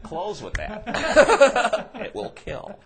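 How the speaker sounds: very slow, high and loud, laughing; dad's advice. Retold or imitated. Dad tells his son how to end the act, slow laughing. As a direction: drag it out, laughing hard. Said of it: "Dad's like".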